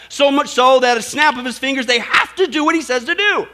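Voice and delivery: loud, emphatic pulpit delivery; sermon preached loudly; full-force preaching. Acted. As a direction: press the point home.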